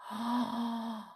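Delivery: high, sharp intake of breath; dramatic storyteller's gasp; shocked gasp. Acted. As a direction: gasp in outrage. Narrator reacts to the throwing.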